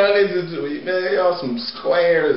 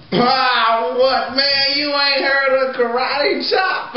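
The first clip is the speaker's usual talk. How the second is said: amazed shout; 6 semitones higher, slower than usual. Acted. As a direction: shout it in disbelief. Amazed someone doesn't know song.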